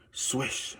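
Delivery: a soft unvoiced swish, breath only; whispered swish. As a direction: breathe the swish out like a sneaking noise. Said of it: "Then she heard something".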